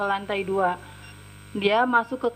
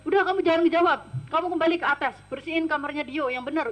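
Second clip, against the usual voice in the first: higher, raised-voice mock command; retold angry order; 5 semitones higher, much faster than usual. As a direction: imitate a boss cutting off back-talk. Retold employer ordering the maid.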